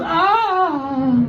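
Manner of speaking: long high-pitched "ahh"; mock orgasmic cry; imitated climax moan. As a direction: let out a drawn-out high moan. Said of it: "And my friend goes".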